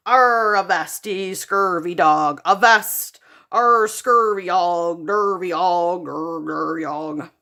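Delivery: loud, slow pirate toy that winds down; bellowing toy pirate. Acted. Talking pirate toy bellowing then running down, pirate voice. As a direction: growl like a broken pirate toy. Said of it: "pirate that bellowed".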